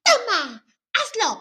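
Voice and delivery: high, loud comic insistence; hammed-up impatient order; mock pestering. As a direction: imitate someone pushily urging you on. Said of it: "te empiezan a presionar".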